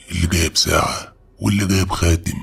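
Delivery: usual speaking voice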